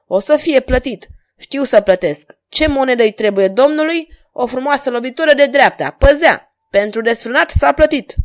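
high, loud fighting banter; bold taunting. A narrator's reading. Swordsman paying back the insult, loud taunting. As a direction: call out each blow in triumph.

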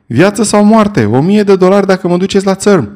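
high, loud yell; a one-word yell to the boat. A narrator's reading. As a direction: bellow it with all your lungs. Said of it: "urla eu din toată puterea plămânilor".

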